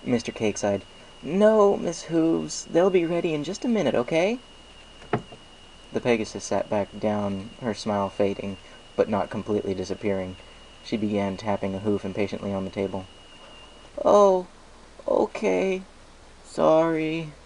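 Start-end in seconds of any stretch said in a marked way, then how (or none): 1.2-4.4 high, friendly shopkeeper pony voice; chirpy baker pleased with business; Mister Cake's voice. A narrator's reading. Baker thanks Applejack for the apples, chirpy and friendly. Mister Cake answers the pegasus. 14.1-17.3 higher, slightly let-down voice; a hint of disappointment, then apology; lightly voiced pegasus mare. A narrator's reading. Pegasus accepts the muffins are not ready, a bit let down.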